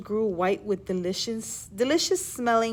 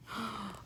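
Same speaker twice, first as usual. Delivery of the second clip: gasp; 14 dB quieter than usual. Acted. Shock in story.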